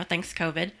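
ordinary talk, nothing marked

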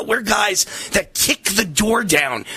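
ordinary talk, nothing marked

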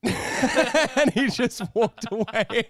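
high, loud laughter through the words; cracking up at the punchline; laughing. Real, not acted. Telling his friend the punchline while laughing hard.

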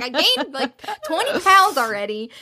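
high-pitched mock complaint; playful pregnant grumbling; pissy. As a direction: act out her cranky grievance. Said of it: "she's so pissy".

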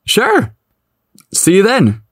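giddy, cracking voice. A narrator's reading. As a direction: voice cracks up high with giddiness. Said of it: "John's voice cracked a bit with giddy excitement".

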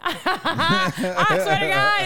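high, slow, laughing through the words; a helpless laugh at the absurdity; laughing disbelief. Real, not acted. Retelling the kids kicking her.

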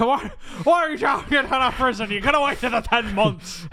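high, loud comic tirade; hammed-up angry shout. Plummer berating his son out of prison, mock angry shout. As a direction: berate him at an exaggerated yell.

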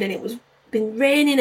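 ordinary talk

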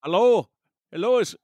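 put-on half-hearted Irish accent; attempted Irish accent. Co-host answers as transporter chief, tentative Irish accent. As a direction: try a light Irish lilt. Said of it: "I'll try to do my Irish accent".

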